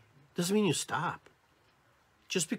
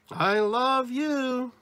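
high, loud, very stretched I love you; sing-song it up high, every word held long; gushing inflection; 11 semitones higher, 6 dB louder, much slower than usual. Shown as a demonstration. Speaker demonstrates inflections, high and drawn out. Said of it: "we use inflections".

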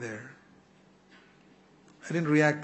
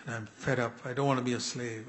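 usual speaking voice

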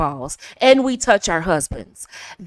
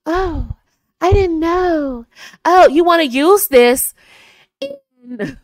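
high, slow, breathy voice; breathy starlet impression; Marilyn Monroe; 8 semitones higher, 5 dB louder, slower than usual. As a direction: coo it on a breath, wide-eyed and airy. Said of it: "She talked very breathy".

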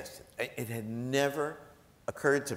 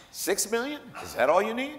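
high, excited mock disbelief; gleeful high-pitched quote; amazed delight; 12 semitones higher than usual. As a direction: toss it off as if six million were pocket change. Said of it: "He said".

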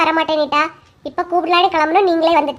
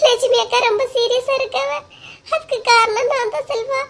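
tearful wail; 5 semitones higher, 5 dB quieter than usual. Acted. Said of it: "ஒப்பாரி வச்சுட்டு இருக்கா".

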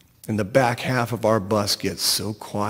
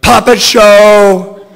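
high, full-force, drawn-out shout; 8 semitones higher, 12 dB louder, much slower than usual. A narrator's reading. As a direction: holler it slowly at top volume.

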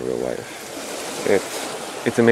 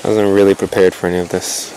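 normal talking voice

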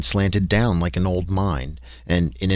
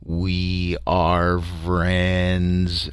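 very slow, drawn-out alien drone; droning creature; 2 semitones lower, much slower than usual. A narrator's reading. Barrel creature droning its greeting at the narrator.

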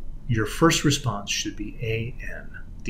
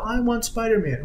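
imitated child's yell; 10 semitones higher than usual. Son repeating his demand to mom, high mock yell. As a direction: whine it high like a stubborn small child. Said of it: "he would just yell".

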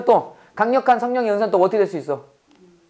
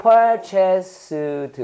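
PURCHASED stretched slowly, syllable by syllable; teacher spelling out PURCHASED by sound, each syllable held; drawn-out syllables; 2 semitones lower than usual. Shown as a demonstration. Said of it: "뭐라고?".

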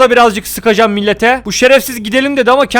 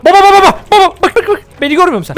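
calling out; 8 semitones higher, faster than usual. Real, not acted. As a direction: shout to get his attention. Calling out to someone.

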